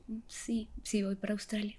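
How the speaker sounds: very soft, half-whispered reply; hushed admission. Retold or imitated. Telling the deported girl she may go, hushed. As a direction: admit it almost under your breath.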